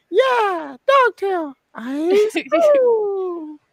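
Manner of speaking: put-on very high squeaky anime girl voice; high cute anime girl. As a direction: chirp it like a cutesy anime streamer. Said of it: "They're like".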